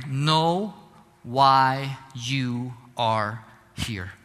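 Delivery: ordinary talk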